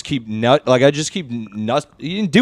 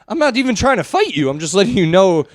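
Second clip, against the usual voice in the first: much higher, pleading imitation; mock alarmed protest; 8 semitones higher than usual. Bystander to someone threatening to fight, high mock-alarmed protest. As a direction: back off in a high, defensive voice. Acts out startled reply to aggressor.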